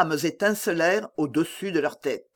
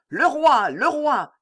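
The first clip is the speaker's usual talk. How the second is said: high excited cries of recognition; cried out; 9 semitones higher, 3 dB louder, slower than usual. A narrator's reading. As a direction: raise the alarm, twice over. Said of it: "crièrent plusieurs voix". (cris aigus de soldats)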